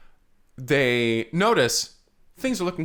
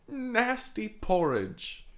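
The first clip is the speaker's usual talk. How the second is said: slow, disgusted echo of the phrase; slow disgusted drawl; 6 semitones higher, 3 dB quieter, much slower than usual. Acted. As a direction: savour the gross words slowly. Mock repeat of phrase.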